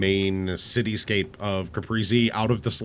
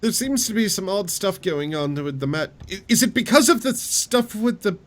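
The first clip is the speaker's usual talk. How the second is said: higher, curious innkeeper; nosy tavern keeper voice; 10 semitones higher, 3 dB louder than usual. Acted.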